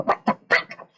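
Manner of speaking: raspy whispered parrot voice; cockatoo squawk. Retold or imitated. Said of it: "teach it how to go".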